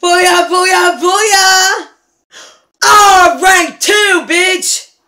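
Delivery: loud, triumphant cheering; victory cheer. Real, not acted. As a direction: crow about the win. Celebrating a race win.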